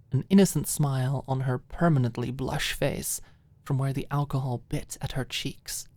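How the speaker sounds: usual speaking voice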